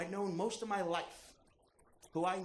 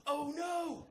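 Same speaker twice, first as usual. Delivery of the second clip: panicked inner shout; 13 semitones higher, much slower than usual. Retold or imitated. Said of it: "I see that and think".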